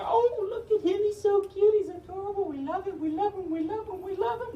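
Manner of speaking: put-on very high cooing over a baby; high sing-song baby gushing; gushing old ladies. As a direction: coo like doting grannies. Mall walkers gushing over baby.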